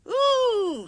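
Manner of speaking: very high, loud shocked shriek; shocked scream. A narrator's reading. Coaches screaming at the rude engine. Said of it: "screamed Agnes".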